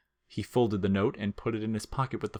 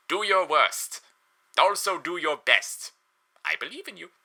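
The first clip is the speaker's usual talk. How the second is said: kazoo yodel voice; 5 semitones higher than usual. Acted. Papyrus grandly waits for the caller's greeting. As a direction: bray it like a proud kazoo. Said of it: "like a kazoo attempting to yodel".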